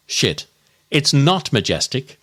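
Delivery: ordinary talk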